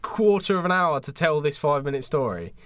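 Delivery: usual speaking voice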